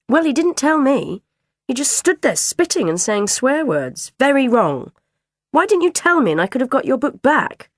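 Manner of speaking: high, loud indignant protest; indignant dinner lady. Acted. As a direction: defend herself, affronted. Dinner lady defends herself.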